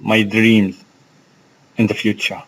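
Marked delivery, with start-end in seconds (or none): none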